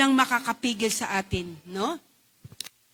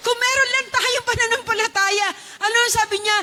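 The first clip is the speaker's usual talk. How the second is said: preaching at full force; 7 semitones higher than usual. Real, not acted. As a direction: preach it out with rising fervor.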